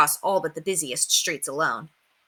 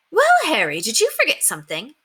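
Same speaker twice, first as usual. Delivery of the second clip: put-on high, chirpy old-lady voice; old neighbour calling to a boy; 7 semitones higher, 3 dB louder than usual. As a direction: sound sweetly curious.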